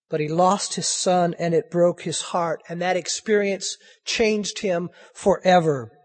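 normal talking voice

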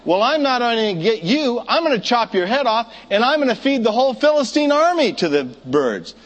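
defiant shout. Acted. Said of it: "and he says".

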